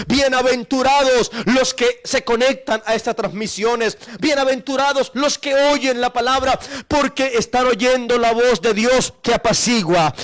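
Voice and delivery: rising sermon crescendo; fervent preaching. Acted. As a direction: preach it out to the congregation. Preacher at full force.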